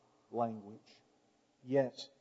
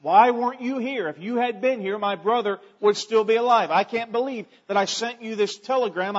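loud, higher, accusing; frustrated Martha; 8 semitones higher, 11 dB louder than usual. Retold or imitated. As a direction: voice her frustration at Jesus. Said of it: "she was expressing her frustration".

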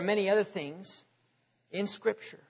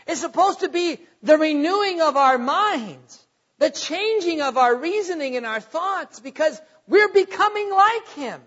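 raised pitch, building to a loud peak; impassioned preaching; 7 semitones higher, 5 dB louder than usual. Real, not acted. Preacher urging believers to be transformed, impassioned and rising. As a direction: drive the point up to a climax.

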